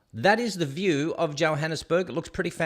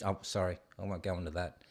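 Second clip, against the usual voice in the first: hushed aside; 10 semitones lower, 10 dB quieter, faster than usual. Real, not acted. Catches himself mid-joke.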